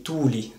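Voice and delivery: "tuli" with the u drawn out long. Shown as a demonstration. Teacher comparing three Finnish words, long u in "tuli". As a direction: stretch the u of "tuli" as in tuuli. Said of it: "une différence dans la prononciation".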